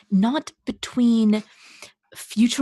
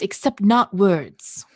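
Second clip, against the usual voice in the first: ordinary talk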